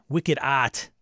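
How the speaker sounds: put-on punchy Boston accent, R dropped. Host echoing a viewer's comment in an emphatic Boston accent. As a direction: hit it like a proud Bostonian. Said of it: "saying that in his Boston accent".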